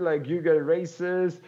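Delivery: usual speaking voice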